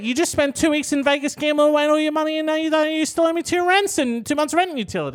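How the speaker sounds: high-pitched indignant mock rant; acted rant. Girlfriend berating boyfriend over gambling and rent, mock rant. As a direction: let it pour out high and exasperated.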